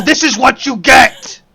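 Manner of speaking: loud high teasing yell; gleeful shout. Real, not acted. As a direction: shout it while tickling. Tickling back, loud.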